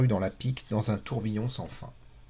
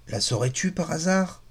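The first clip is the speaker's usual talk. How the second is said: suddenly suspicious; 4 semitones higher than usual. Acted. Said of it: "pris d'un soupçon subit".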